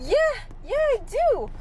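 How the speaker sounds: high, rising eager agreement; eager yes. Retold or imitated. Child eagerly telling staff she speaks Spanish. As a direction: jump at the chance to help.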